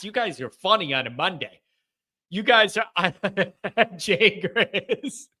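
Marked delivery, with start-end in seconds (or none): 2.3-5.3 words broken up by laughter; real laughing. Real, not acted. Host reading jokes in the chat, cracking up. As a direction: crack up while trying to talk.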